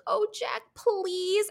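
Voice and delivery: very high, cooing mock plea; Chrissy. Co-host imitating the blonde coaxing her roommate, high pleading coo. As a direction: mimic a girl wheedling, high and light. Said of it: "she's like".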